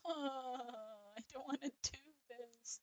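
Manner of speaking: soft, slow, high-pitched whine; mock whimpering. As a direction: whimper reluctantly, dragging the words out. Dreads doing another voice.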